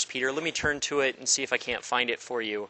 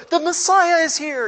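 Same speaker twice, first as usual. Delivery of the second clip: high, loud mock herald cry; hammed-up, high-pitched announcing; excited crowd proclamation; 14 semitones higher, 6 dB louder than usual. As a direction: shout the big news to everyone. Everybody proclaims Messiah.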